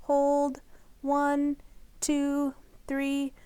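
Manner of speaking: gentle counted breathing guide; soft counting for a child's breath; breathing exercise count. Acted. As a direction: count the inhale softly and evenly.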